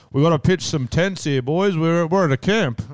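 put-on rally the boys at the campsite; camp leader voice. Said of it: "'Cause there's firewood".

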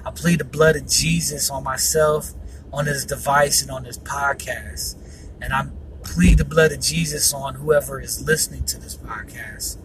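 prayer. Acted. Prays against yawning, pleads blood.

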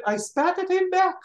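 high, gleeful punchline as the aunt; triumphant aunt. Retold or imitated. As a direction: deliver the aunt's punchline with glee. Aunt's punchline, retold triumphantly.

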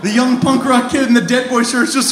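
ordinary delivery

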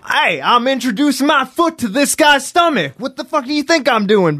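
high-pitched Southern trash talk; Southern accent. Shown as a demonstration. Wrestler taunting the referee while kicking a rival, high Southern accent. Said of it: "Give me a Southern accent".

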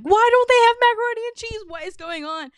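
shrill, high, loud and rushed; panicking over no macaroni and cheese; frantic outburst. Retold or imitated. Craving macaroni and cheese and finding none, high frantic squeal. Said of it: "and I'm like".

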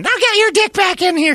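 high loud calling-in imitation; shrill woman voice. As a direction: yell it high like a nagging wife. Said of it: "she wouldn't be going".

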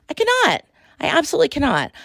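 high-pitched exasperated squeal. Real, not acted. Host fed up with commenters, high squeal. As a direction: squeak it out in disbelief.

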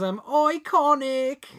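high, drawn-out mock Australian wrestler; Australian female wrestler. Quoting female wrestlers to a co-host, high Australian accent. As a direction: put on an Australian woman wrestler's catchphrase voice. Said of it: "doing female wrestler voices".

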